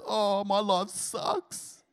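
slow, whiny mock complaint; mock moping. Pastor mocking a gloomy Tamworth local. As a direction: mimic a sulky passer-by.

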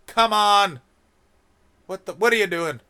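exasperated shout. Real, not acted. Berating America, he shouts it out loud. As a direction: Come on! yelled high and loud.

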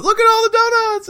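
high-pitched mock excited squeal; flipping out. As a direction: squeal it loudly, imitating someone losing it over treats. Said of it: "she flips out and goes".

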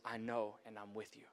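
hushed, tender voice of comfort; God's tender voice. Retold or imitated. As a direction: comfort them as God would, gently. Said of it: "And He says".

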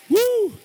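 loud, very high whoop. Real, not acted. Preacher firing up the congregation, loud high whoop. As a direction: whoop like a fan at a game. Whipping up the crowd's applause.

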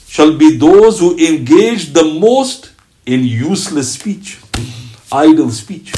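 fervent slow exhortation; preaching warning. Acted. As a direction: press the warning home, word by word. Impassioned warning read slowly.

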